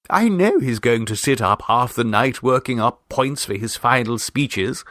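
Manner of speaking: ordinary delivery, nothing marked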